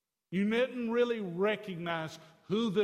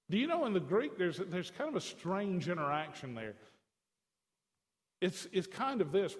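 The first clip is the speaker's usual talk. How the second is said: normal talking voice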